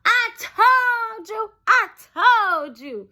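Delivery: high, slow sing-song I told you; mocking told-you. Friend taunting her after the fall, slow high sing-song. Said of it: "you can hear [name] go".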